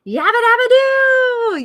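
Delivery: loud, high, drawn-out cartoon cheer; happy yabba dabba doo. Shown as a demonstration. Coach giving a happy sound, a loud cartoon cheer. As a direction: belt it out like a happy cartoon caveman. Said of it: "just like you did with these happy sounds".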